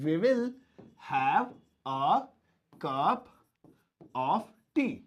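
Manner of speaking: "we will... have... a... cup... of tea", with long pauses; word by word. Shown as a demonstration. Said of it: "ये बोलेंगे". ("we will have a cup of tea" को रुक-रुककर बोलें)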